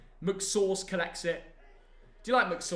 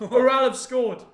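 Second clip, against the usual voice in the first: loud outcry; 5 semitones higher, 8 dB louder, slower than usual. Real, not acted. Reacting to live score update.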